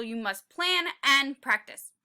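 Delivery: usual speaking voice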